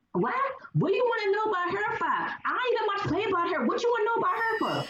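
put-on sassy Charleston accent, grilling him quickly. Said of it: "in my best Charleston voice".

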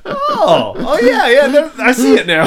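high, loud words through laughter; laughing realisation. Retold or imitated. Johan realising it was Wales, high laughing voice. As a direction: laugh at yourself as it clicks.

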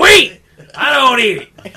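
high, loud indignant shout; outraged duck shouting back; shouting duck impression. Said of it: "Bravo on the impression".

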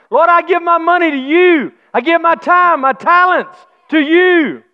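fervent preaching with shouted thanks; preaching at full force. Acted. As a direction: lift it up like a Sunday preacher. Pastor preaching about giving.

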